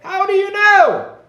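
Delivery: high, loud, slow disbelieving outburst; startled demand. Retold or imitated. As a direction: shout it in mock alarm. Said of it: "I'll be like".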